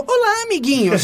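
high, sugary presenter greeting; kids' show host. Retold or imitated. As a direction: chirp it like a children's TV hostess.

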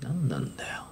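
soft, low imitated grumble; grumbling stranger. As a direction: grumble under your breath like an annoyed stranger. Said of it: "みたいな人の場合には".

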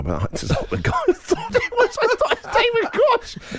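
high, breathless laughing through words. Real, not acted. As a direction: laugh helplessly mid-sentence. Laughing retelling the blunder.

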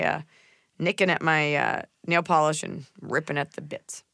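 ordinary delivery

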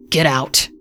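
short, controlled snarl; snarled dismissal, without shouting. A narrator's reading. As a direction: snarl the order to leave. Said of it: "she snarled".

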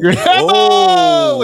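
Grabe O called out high and loud; calling out. Acted. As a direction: shout Grabe O up high like a game host. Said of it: "we're just gonna go".

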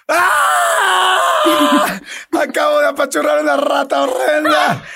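high screaming confession of disgust. Retold or imitated. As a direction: scream it out to the meeting. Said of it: "Y yo".

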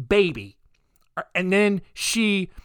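usual speaking voice

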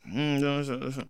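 soft mumbled nonsense syllables; murmuring. Retold or imitated. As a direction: murmur wordless filler under your breath. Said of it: "I'm murmuring words".

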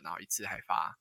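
ordinary talk

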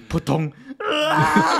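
拉长的高声惨叫夹着笑; 慢慢拖长一声痛苦的啊; 扑通跪地惨叫. Retold or imitated. 重演跪地惨叫. (边笑边重演跪地大叫)

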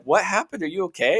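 high, concerned imitation of the wife; worried wife. Husband imitates his wife anxiously asking if he's okay.

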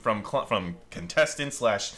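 usual speaking voice

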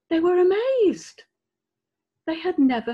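amazed. A narrator's reading. Narrator marvels at the crowd.

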